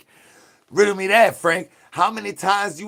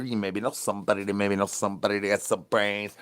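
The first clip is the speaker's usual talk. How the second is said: low mumbled reply voice; 6 semitones lower, 7 dB quieter, faster than usual. Acted.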